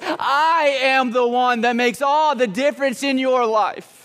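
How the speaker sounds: high, drawn-out preacher's cadence; impassioned preaching. Real, not acted. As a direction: drive each "I am" home, slowly. Preaching at full force.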